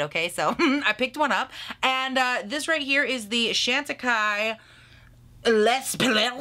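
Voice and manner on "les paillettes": Les paillettes spoken with a drawn-out first attempt; tentative French attempt. Real, not acted. Vlogger reads the French product name, trying it out.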